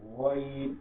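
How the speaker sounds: গইন scraped in the throat, drawn out; throat-rubbed ghain. Shown as a demonstration. Teacher models গইন, long with throat friction. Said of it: "গলা ঘষে".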